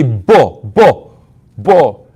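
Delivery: bo repeated with a voiced b, not pa. Shown as a demonstration. Teacher showing how Arabs say bo for pa. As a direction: hammer bo, bo, bo as the wrong sound. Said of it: "instead of saying pa, they say".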